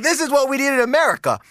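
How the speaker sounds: high, quick burst of amused enthusiasm; excited approval. Retold or imitated. Cheering Qatar's rules for America, excited approval. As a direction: blurt it out fast, tickled by the idea.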